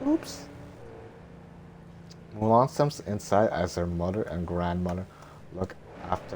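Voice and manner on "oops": put-on very high, breathy young heroine; high girl voice. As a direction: lift into a high girlish falsetto. Mulan's voice.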